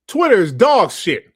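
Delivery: loud angry blurt; angry outburst. Real, not acted. Streamer venting at Twitter, loud and angry. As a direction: snap it out loud in anger.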